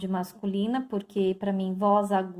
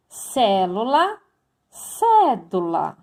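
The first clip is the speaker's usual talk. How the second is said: slow example words; 6 dB louder, slower than usual. Shown as a demonstration. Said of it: "teacher sounds out example words".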